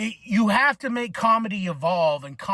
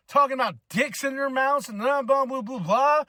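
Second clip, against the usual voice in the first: mocking young critic; 6 semitones higher than usual. Kids mocking him as old man.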